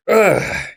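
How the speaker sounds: high, loud imitated groan of effort; straining groan. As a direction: act out a tense grip with a strained grunt. Imitates straining while gripping the car.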